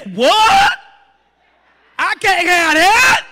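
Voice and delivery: loud, high mock outrage; hammed-up shocked protest, stretched out; indignant disbelief. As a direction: balk loudly at the doctor's orders. Acts out dieter reacting to doctor.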